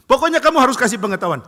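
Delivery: loud, high furious demand; angry demand. Acted. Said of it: "anak ini marah".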